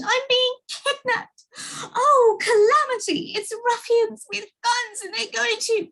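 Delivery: high, frantic, crying out; panicked shriek. A narrator's reading. Mister Jelly thinks ruffians have him, high frantic cry. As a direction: babble in terror about kidnappers. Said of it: "he screamed".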